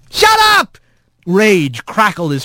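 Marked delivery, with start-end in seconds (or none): 0.1-0.8 high-pitched furious outburst; enraged yell. Acted. Rat silences the taunting voice, high furious yell. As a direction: cut him off with a high yell.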